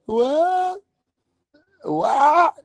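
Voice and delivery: put-on high, dazed drunken what; squeaky mock drunk confusion; confused drunk. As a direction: play a baffled drunk who can't follow. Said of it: "And he goes".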